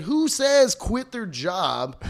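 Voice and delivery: high, slow disbelieving cry; who says quit their job, drawn out in shock; incredulous. Retold or imitated. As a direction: stretch it out in total disbelief. Incredulous retort to odd offer.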